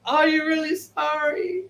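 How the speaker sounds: high, slow, drawn-out doubt; skeptical. Acted. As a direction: question the apology in a stretched, high mock voice. Doubting an apology in her head.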